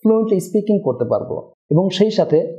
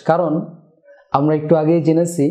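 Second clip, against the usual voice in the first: ordinary talk, nothing marked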